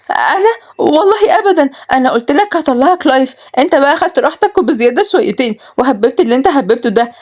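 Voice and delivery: high-pitched, panicky denial; fearful. A narrator's reading. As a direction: squeak out excuses to dodge the blame. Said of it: "روز بخوف".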